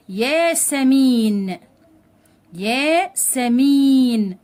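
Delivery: ياسمين said very slowly and loudly, clear y, long held "ee" before the final n; ياسمين in slow teaching syllables, "yaa-sa-meeen"; drawn-out long vowel. Shown as a demonstration. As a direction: stretch the "mee" in ياسمين. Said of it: "أطالت في الحرف اللي قبليه".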